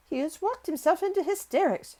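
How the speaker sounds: high, urgent alarm from a flustered nurse; hurried, agitated. A narrator's reading. Nurse fetching help for a hysterical boy, hurried and agitated. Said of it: "she said in a great hurry".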